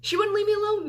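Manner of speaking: high, loud fed-up complaint; exasperated. Real, not acted. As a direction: vent frustration.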